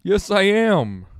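slow, high, loud insistence; defensive high shout. Real, not acted. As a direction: shout it back slowly and high. Denying being mad, defensive.

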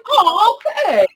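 loud, high mock "oh"; sister's reaction imitation. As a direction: leap up in pitch on the comeback.

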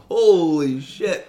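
slow, high, loud mock yelp of pain; retold yelp. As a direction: drag out the shocked yelp. Retold burn of alcohol wipe.

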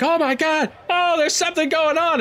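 high-pitched alarmed crowd voices; comic high panic; panicking bystanders. Acted. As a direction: cry out like startled onlookers. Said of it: "a bunch of people like".

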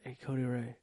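low, soft retold whisper, still voiced; hushed aside. Man secretly calling Cody Ray, hushed imitation of whispering. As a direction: murmur it like a secret call. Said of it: "so I whispered".

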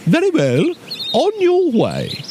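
high owl, slowing on the last words; mollified owl. A narrator's reading. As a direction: grant passage as a flattered owl. Flattered owl lets bear pass.

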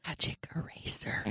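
soft whispered mishearing. Acted. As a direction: whisper it back softly. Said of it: "We are all whispering".

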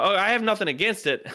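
high-pitched defensive protest. Retold or imitated. Comic retelling his reply to the driver about Mormons, high and defensive. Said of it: "Immediately took the defensive".

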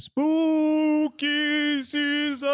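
very slow high ghostly moan; high spooky wail. Pretend. Intro spooky voice.

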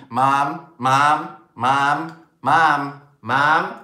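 loud, insistent repeated calling; nagging child. Acted. Kids grabbing a parent's attention on the phone, nagging. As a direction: pester loudly, each call identical.

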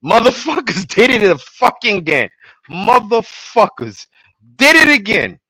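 hammed-up fury at the show; mock outrage.

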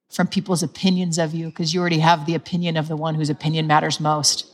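ordinary talk

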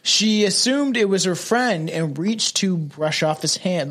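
usual speaking voice

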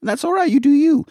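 high, soothing parent voice; gently pat him on the head; mock reassuring parent.

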